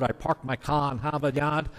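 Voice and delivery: put-on a light Boston accent, dropped r's in parked, car and Harvard; lightly imitating a Boston Harvard accent. Preacher imitating a Boston Harvard accent for the congregation, light Boston accent. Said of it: "you hear the Boston Harvard".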